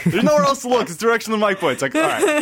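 high, loud laughing protest. Real, not acted. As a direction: laugh it off, defending yourself. Laughing defensively at teasing.

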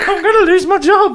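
high, loud wail through laughter; mock panic. Flustered podcaster over the broken screen, mock panic. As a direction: laughingly overplay losing it in a panic.

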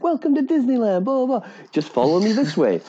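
high, bubbly theme-park greeter voice; cheery cast member. Retold or imitated. Retold Disney worker greeting guests brightly. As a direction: sell the magic, sweet and high. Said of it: "this girl's so happy".

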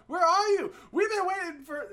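high, loud impression of the stranded rider; angry caller. Friend imitates the angry Lyft rider calling the driver, high and loud. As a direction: jump way up in pitch like an impatient woman on the phone. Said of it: "She's like".

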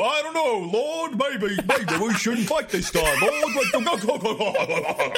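high, dithering mock-cowardly minions; bumbling orc army. Player's own orcs losing their nerve before the lord, comic dithering. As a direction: babble nervously to your lord, hammed-up. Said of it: "then has your army go".

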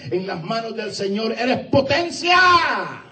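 rising shouted climax on "potencia"; climactic shout. Acted. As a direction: build to a shouted peak on "potencia". Climactic preaching. (clímax gritado en "potencia")

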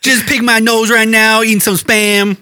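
high, loud put-on cowboy twang. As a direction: ham up a hillbilly cowboy. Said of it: "we were talking in that manner".

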